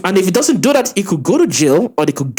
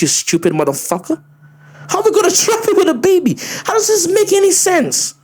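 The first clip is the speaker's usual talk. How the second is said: exasperated outburst; 8 semitones higher than usual. Real, not acted. Exasperated rant against her video.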